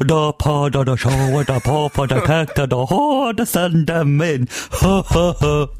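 very drawn-out, hammed-up radio intro; radically altered catchphrase. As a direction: stretch the announcement into showy nonsense, ending in staged laughter. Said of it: "I changed it radically".

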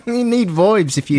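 high-pitched, broad Aussie parody; mock Australian accent. Radio host introducing Melbourne band, mock Australian. Australian skit, exaggerated vowels.

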